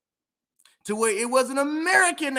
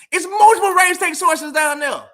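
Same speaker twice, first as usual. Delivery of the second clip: loud emphatic; 8 semitones higher, 8 dB louder than usual. Real, not acted.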